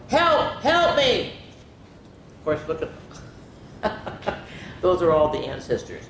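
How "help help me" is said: high-pitched storybook yell; acted alarm, pitched way up; yelled cry for help. A narrator's reading. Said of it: "and she yelled".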